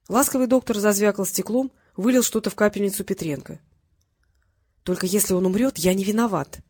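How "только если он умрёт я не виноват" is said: usual speaking voice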